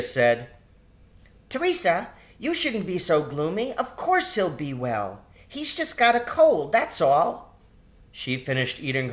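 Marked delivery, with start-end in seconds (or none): 1.5-7.6 put-on higher, comforting girl voice; reassuring sister. A narrator's reading. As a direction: talk her out of gloom.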